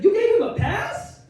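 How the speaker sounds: incredulous bystander. Retold or imitated. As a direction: ask it like you can't believe it.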